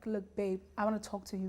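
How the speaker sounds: low, soft relationship talk; hushed, careful opener; rehearsed partner's opener. Acted. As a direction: drop it low and soft to your babe. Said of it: "start from being like".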